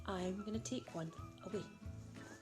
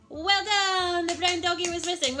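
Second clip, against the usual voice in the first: excited praise; 6 semitones higher, 6 dB louder than usual. Real, not acted. Praising child in kids game.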